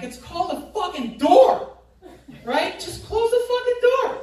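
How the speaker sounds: heated yell. Retold or imitated. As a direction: shout the obvious at her. Yelling at the lady in bank.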